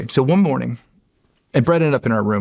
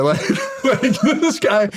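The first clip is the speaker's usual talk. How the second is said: amused disbelief; 9 semitones higher, slower than usual. Real, not acted. Laughing disbelief.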